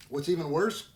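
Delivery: ordinary delivery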